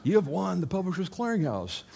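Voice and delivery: high, excited prize-announcer voice; Ed McMahon. Retold or imitated. Prize presenter arriving with the big check, imitated excited announcement. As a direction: proclaim a big win, pitch way up. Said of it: "Ed McMahon showed up with the big check".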